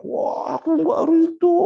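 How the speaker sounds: high, loud, slow wail of protest; mock wailing shout. Preacher imitating a wailing mourner, mock shrieking. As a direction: wail it like a mourner who won't accept. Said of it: "Wah, teriak-teriak".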